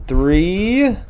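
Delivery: loud, rising coach countdown; hyped countdown. Real, not acted. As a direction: fire up the start.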